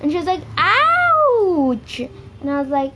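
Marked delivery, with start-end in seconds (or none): 0.6-2.0 long, high mock yelp of pain; hammed-up, stretched cry at a much higher pitch; exaggerated ouch. Friend's cry after hand is hit.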